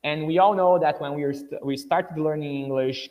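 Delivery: usual speaking voice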